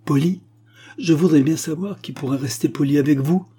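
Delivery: high, loud scornful disbelief; incensed spinster scoffing at her neighbour; indignant outburst. Acted. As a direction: throw the word back in his face. Silence indignant at the old man.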